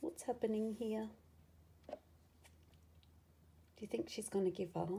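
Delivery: ordinary delivery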